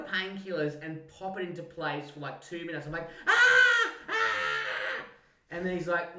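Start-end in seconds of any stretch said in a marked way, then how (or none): 3.3-5.0 very high, loud mock scream; repeated hammed-up shrieks of agony; imitated pain scream. Patient having toe popped in without painkillers, screaming in pain.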